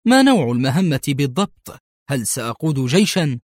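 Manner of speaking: plain reading voice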